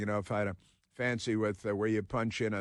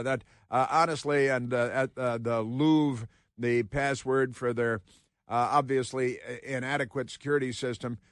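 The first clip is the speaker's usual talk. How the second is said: ordinary talk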